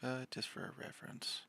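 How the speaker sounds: soft, breathy whisper to herself. Real, not acted. Talking to herself while drawing, in a whisper. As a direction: murmur while concentrating on the drawing.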